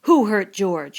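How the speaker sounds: loud, menacing demand; angry demand. A narrator's reading. Lenny threatening Crooks, loud angry demand. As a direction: loom over him and demand it. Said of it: "he demanded".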